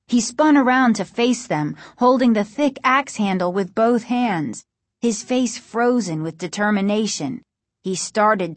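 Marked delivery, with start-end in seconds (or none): none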